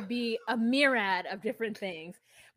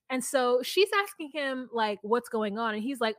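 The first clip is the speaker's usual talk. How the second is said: usual speaking voice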